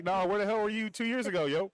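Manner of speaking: high, put-on irritated voice; half-joking pissed-off voice; mock annoyed. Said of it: "I'm a little pissed off".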